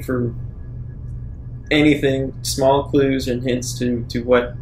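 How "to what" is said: ordinary talk, nothing marked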